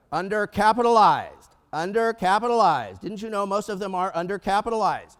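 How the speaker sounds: loud, drawn-out damning word; loaded inflection. Retold or imitated. Colleagues condemning small business owners, loud loaded inflection. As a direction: stretch it out like a scandalous diagnosis. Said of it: "say as much by your inflection".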